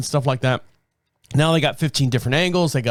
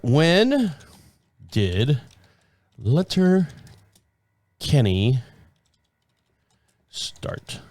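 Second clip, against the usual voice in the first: drawn out word by word, trailing off; slow, distracted repeat; much slower than usual. Real, not acted. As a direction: say it slowly while looking it up. Repeats it while looking it up.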